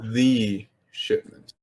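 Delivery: drawn out, weighty and pointed; slow pointed. Acted. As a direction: lean slowly on each word. Master's pointed correction.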